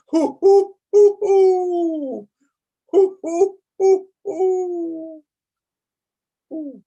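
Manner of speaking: high, loud owl hoo, hoo; owl hooting. Shown as a demonstration. Laughter teacher to the class, hooting like an owl. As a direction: hoot like an owl. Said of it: "let's be like an owl".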